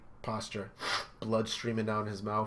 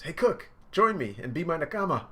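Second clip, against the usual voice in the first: flashback Luffy voice; 7 semitones higher, 6 dB louder than usual. Retold or imitated. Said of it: "flashback, uh, voice".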